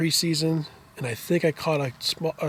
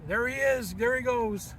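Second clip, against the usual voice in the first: high, drawn-out mock call; imitated yell; 9 semitones higher, slower than usual. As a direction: call it out high without really yelling. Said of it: "all of a sudden yells".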